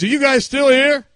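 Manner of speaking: high, loud, irritated question; retold irritation. Angry man finds visitors still there, mock irritated outburst. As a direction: imitate a still-annoyed host. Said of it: "he's still kinda angry".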